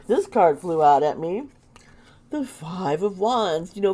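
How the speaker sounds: ordinary talk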